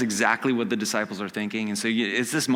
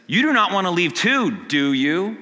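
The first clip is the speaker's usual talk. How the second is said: louder, higher challenge; Jesus pressing his followers, louder and higher; pointed question; 6 semitones higher, 5 dB louder than usual. Acted.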